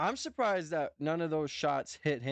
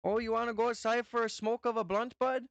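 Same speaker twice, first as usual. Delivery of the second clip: higher, lightly hammed North Dakota accent; mock North Dakota accent offering a smoke; 6 semitones higher than usual. Shown as a demonstration. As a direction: show off the North Dakota twang, pitched up. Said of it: "that's how we talk".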